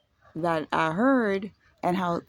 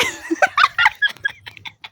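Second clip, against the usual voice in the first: very high squealing laugh; real high laughing shriek; 6 dB louder than usual. Real, not acted. Embarrassed at her confession.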